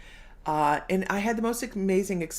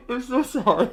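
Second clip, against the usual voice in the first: real laughter, higher and louder through the words; laughing; 7 semitones higher, 6 dB louder than usual. Real, not acted. Host cracking up at a viewer's mishap, laughing. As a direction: laugh helplessly while apologizing. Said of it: "And I'm laughing".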